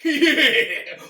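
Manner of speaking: loud, high laughing yeah; laughing cheer. Real, not acted. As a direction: burst out cheering through a laugh. Cheering the kill scene.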